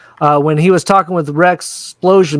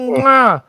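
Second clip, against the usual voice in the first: mock kiss sound; 8 semitones higher than usual.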